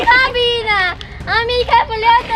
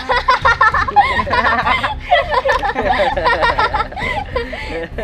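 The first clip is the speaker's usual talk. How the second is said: mocking cackle; 4 semitones higher than usual. Bully mocks victim's smeared face.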